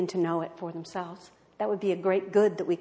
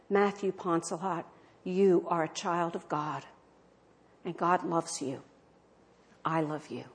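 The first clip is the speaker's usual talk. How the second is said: lower, softer, fading to love; tender blessing; 4 semitones lower, 5 dB quieter than usual. Retold or imitated. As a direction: comfort him gently. Nun comforting condemned man.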